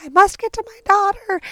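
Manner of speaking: high, loud mock desperation; imitating desperate mother. Mother held back by police from her daughter, mock desperation. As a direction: plead frantically as an overwrought mother.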